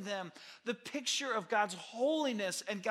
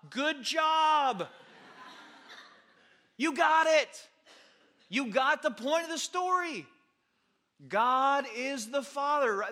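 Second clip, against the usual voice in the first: higher, louder cheering shout; excited praise; 6 semitones higher, 9 dB louder, slower than usual. Real, not acted. Preacher cheering congregation for the right answer, excited shout. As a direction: celebrate them like a coach.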